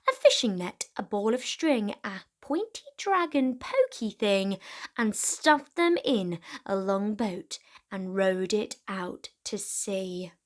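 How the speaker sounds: ordinary delivery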